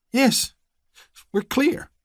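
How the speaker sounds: very high, small frightened reply; cowed answer. Acted. Vernon submitting to the deputy, frightened. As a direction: give in, scared.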